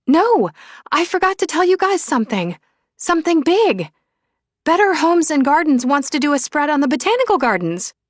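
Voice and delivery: high, loud burst of big news; excited woman friend. Acted. Friend shares magazine news with the group, excited. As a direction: announce it excitedly, well above normal pitch.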